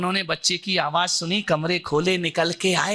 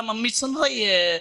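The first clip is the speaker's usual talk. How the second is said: ordinary talk, nothing marked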